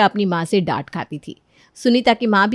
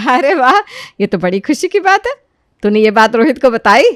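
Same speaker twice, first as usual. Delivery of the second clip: put-on louder, delighted older woman; pleased mother-in-law; 4 semitones higher, 7 dB louder than usual. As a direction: share the good news joy. (खुश होती सास, ऊँची आवाज़ में)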